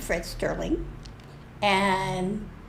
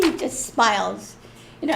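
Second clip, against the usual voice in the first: normal talking voice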